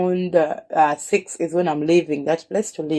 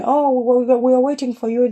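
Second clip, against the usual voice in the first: higher and quick, words tumbling out; eager sisters; 6 semitones higher than usual. Retold or imitated. Sisters on the phone to their sister, eager and rushed. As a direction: rush it like relieved, eager sisters. Sisters calling about the baby.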